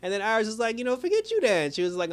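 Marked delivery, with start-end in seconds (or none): 0.8-1.7 high, sassy brush-off; sassy dismissal. Retold or imitated. Iris brushing off Cecile, high and sassy. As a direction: toss it off with attitude.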